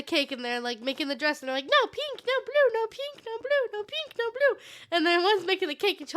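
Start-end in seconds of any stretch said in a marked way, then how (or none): 1.6-4.6 high, sing-song mock squabble; bickering fairies. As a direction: squeak it like quarrelling little fairies. Fairies bickering over dress colour.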